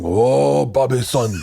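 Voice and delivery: imitated mock Korean father, drawn out; slow, hammed-up mock Korean accent. Donnell playing Bobby's father, imitated Korean accent. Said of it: "very inappropriate accents of each other's race".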